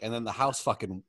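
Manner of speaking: ordinary delivery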